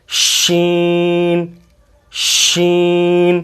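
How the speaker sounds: شین drawn out very slowly, ending on n; a long, hushing شین; slow letter demo. Shown as a demonstration. Teacher models شین for students. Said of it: "آخر میں بھی نون کی آواز آئے گی".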